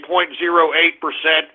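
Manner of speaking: ordinary delivery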